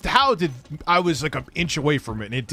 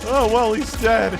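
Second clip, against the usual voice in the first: slow, sarcastic repeat; mocking echo; 8 semitones higher, 4 dB louder than usual. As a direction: drag it out with mock surprise.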